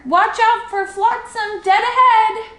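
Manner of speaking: high, loud warning shout; owner calling. A narrator's reading. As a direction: yell the warning from shore. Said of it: "cried the owner".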